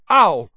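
sudden loud high ow; yelp of pain. A narrator's reading. Lockhart kicked in the shins, loud yelp.

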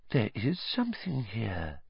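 soft hushed seer voice; whispered. Acted. Trelawney peering into crystal ball, hushed. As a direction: hush it as if spotting an omen. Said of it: "Professor Trelawney whispered".

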